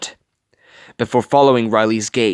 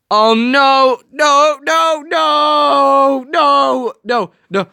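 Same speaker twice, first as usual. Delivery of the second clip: much higher string of nos; panicked; 12 semitones higher, 4 dB louder, much slower than usual. Real, not acted. Viewer panics as Tadashi runs into the flames, high pleading.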